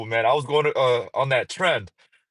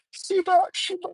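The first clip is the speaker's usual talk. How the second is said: mocking Shiba. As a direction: ham up the annoying trend voice. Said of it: "that's every other video".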